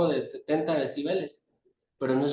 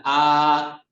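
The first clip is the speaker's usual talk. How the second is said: A held long and said much louder; loud vowel demo; 8 dB louder than usual. Shown as a demonstration. Teacher showing how loud an A vowel gets, loud drawn-out A. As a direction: belt out a slow, long A. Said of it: "cuando se dice fuerte ronda los sesenta decibeles".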